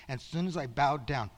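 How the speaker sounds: ordinary delivery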